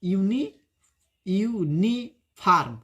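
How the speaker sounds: Uni- repeated, then a slow, drawn uniform; teacher drilling uniform slowly; slow syllable drill. Shown as a demonstration. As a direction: break uniform into parts for learners. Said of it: "गलत हो जाएगा ना".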